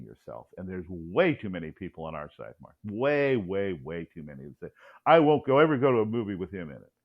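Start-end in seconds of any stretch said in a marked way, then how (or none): 5.1-6.9 louder, hammed-up refusal; mock stubborn refusal. Imitating conservatives who boycott actors' movies, mock stubborn refusal. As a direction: imitate someone digging their heels in.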